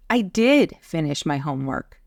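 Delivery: insist, leaning on "did"; stress on DID. Shown as a demonstration. Boy answering mother's doubt about homework, insistent stress on "did". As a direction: hit "did" hard to contradict. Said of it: "he wants to insist".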